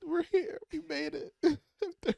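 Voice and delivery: high, loud celebratory squeal; overjoyed. Real, not acted. Co-host celebrating the return to a long-awaited novel, overjoyed. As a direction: squeal it high, as if finally arriving.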